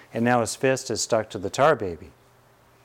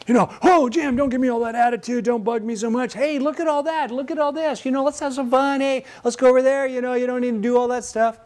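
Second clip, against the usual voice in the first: high nervous rambling Richard Pryor voice; a teacher mimics a comic spinning a smoke screen; Richard Pryor impression; 13 semitones higher than usual. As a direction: babble fast and high, dodging the question. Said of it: "Notice Richard Pryor's just spinning out".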